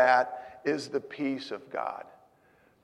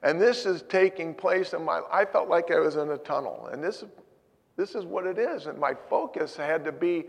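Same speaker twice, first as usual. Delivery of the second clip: ordinary talk, nothing marked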